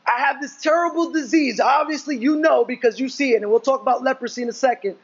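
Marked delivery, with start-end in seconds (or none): 0.0-1.6 lightly acted pleading leper; imagined leper's plea. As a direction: beg Jesus for healing, lightly voiced. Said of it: "He doesn't ask Jesus".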